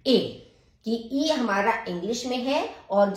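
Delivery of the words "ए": ए held long, lifted; German E as e. Shown as a demonstration. Teacher drawing out ए for students. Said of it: "ई को ए".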